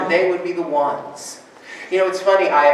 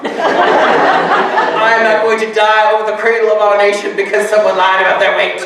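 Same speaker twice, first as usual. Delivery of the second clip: laughing; 4 semitones higher, 7 dB louder than usual. Retold or imitated. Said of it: "She goes".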